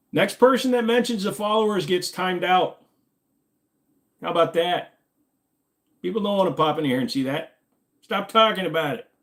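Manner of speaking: high-pitched annoyed warning; exasperated scolding. Real, not acted. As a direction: lay down the law to the chat. Scolds chat for mentioning followers.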